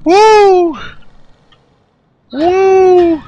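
high, loud, joyful whoops; victory whoops. Real, not acted. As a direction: whoop it out like you just won big. Cheering after getting the Platinum.

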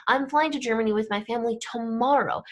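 "tomorrow" punched out at the end; quick sentence with emphasis on "tomorrow"; stress on tomorrow. Shown as a demonstration. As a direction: race through, then lean on "tomorrow". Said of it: "we would use our voice".

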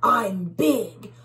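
loud growling boast, slowing down; bully bug. Acted. Bully bug boasting to tiny bugs, loud and menacing. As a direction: gloat like a hungry monster. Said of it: "says the big bad bully bug".

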